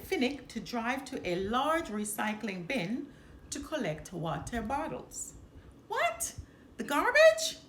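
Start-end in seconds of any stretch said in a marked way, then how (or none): 5.9-7.6 much higher, louder incredulous yelp; outraged disbelief. Acted. Finnick balking at digging in garbage, shocked squawk. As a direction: squawk it like he can't believe it. Finnick disgusted at recycling bin.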